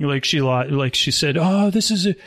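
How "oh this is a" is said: higher, mildly raised yelling; worked-up scolding, not full volume; mock yelling. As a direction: imitate a sister starting to yell. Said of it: "then she started yelling".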